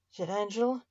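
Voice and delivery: ordinary delivery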